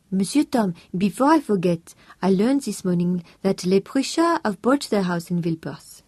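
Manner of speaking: put-on lightly accented maid sharing gossip; French housekeeper voice. A narrator's reading. Housekeeper tells Tom the neighbours' news, eager and French.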